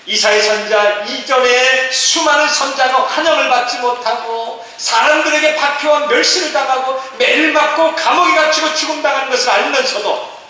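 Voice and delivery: high, loud, sustained proclamation; full-force preaching. Acted. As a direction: preach at the top of the register without letting up. (높고 크게 부르짖는 설교)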